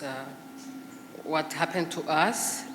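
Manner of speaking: ordinary talk, nothing marked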